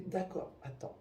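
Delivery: whispered quick hold-on; whispered wait a moment; hushed aside. Retold or imitated. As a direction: murmur it off to the side. Woman on phone, hushed aside.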